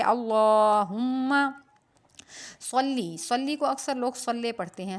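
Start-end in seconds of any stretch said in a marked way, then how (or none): 0.0-1.3 اللہم with a heavy, full lam, drawn out slowly. Shown as a demonstration. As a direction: say اللہم with thick لام. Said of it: "اس کو ہم موٹا پڑھیں گے". (اللہم موٹا لام کے ساتھ)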